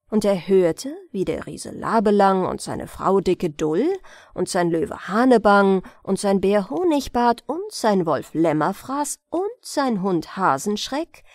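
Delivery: usual speaking voice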